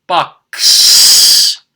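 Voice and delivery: "Box" with an extra s hissed on, unvoiced; box plus s. Shown as a demonstration. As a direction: say "Box" ending in a long ss, no extra syllable. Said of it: "it would sound like this".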